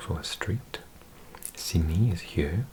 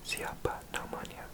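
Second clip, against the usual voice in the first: Siapa namanya whispered much softer, like an echo; fainter whisper; 10 dB quieter than usual. Shown as a demonstration. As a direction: breathe Siapa namanya barely audibly.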